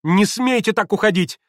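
high, loud, indignant order; shrieked girlish command; crying out. A narrator's reading. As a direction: shout to stop someone leaving. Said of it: "вскричала было Лис".

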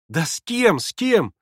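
high, insistent questioning; urgent girlish exclamation; impatient exclamation. A narrator's reading. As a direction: demand an answer, pitch jumping on the repeat. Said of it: "воскликнула Лис".

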